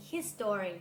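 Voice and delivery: history with a full O, his-STO-ry; history as his story. Shown as a demonstration. Teacher shows the mistake, history as three-syllable his-STO-ry. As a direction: pronounce history like the two words his story. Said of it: "เพราะถ้าออกเสียงเป็น history".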